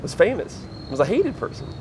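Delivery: pitched high in wonder; amazed emphasis. Retold or imitated. Telling of a famous yet present performer, amazed. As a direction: lift each phrase high, marvelling. Marvelling at famous hated person.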